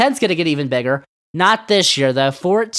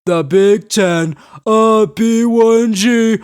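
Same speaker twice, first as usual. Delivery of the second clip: mocking boaster. Sports host mocks the Big Ten's overrated boasting, mocking.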